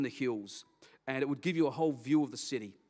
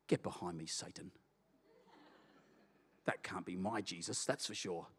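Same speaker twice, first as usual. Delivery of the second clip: low, much softer rebuke of Satan; muttered rebuke; 10 semitones lower, 9 dB quieter than usual. Retold or imitated. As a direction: push the thought away, low and muttered. Rebuking the doubting inner voice.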